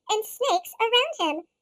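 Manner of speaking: plain reading voice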